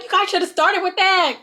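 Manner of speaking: mock-teary complaint about the peppers; high complaining quote. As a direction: whine it up high in a put-on voice. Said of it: "He's like".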